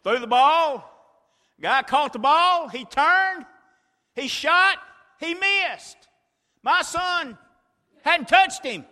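high, loud breathless play-by-play; excited retelling. Acted. Preacher reliving the final seconds for the church, excited shouting. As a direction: build the crowd's frenzy.

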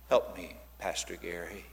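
ordinary talk, nothing marked

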